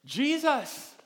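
loud, high preacher's fervour; preaching. Acted. Pastor rousing the church, fervent preaching. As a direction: preach it out with fire to the congregation.

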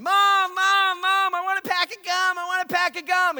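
put-on high, loud begging kid voice; child pleading for gum; whiny child. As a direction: whine like a little boy at checkout.

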